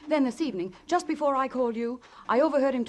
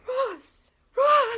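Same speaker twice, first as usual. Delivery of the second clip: high, loud repeated screams of his name; frantic screaming; 14 semitones higher, 5 dB louder than usual. Acted. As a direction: call him again and again in panic. Wife sees husband's body.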